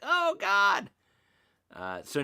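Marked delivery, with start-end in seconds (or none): 0.0-0.9 high, loud panicked cry of alarm; imitating panic. Retelling finding battery acid, a high loud mock shriek. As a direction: squeal it like you just found battery acid. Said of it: "And I'm like".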